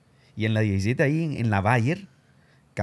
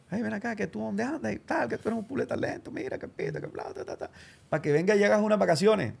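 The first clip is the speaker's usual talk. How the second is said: station boss's voice; 8 semitones higher than usual. Retold or imitated. Station boss coaxing him back, higher mock voice.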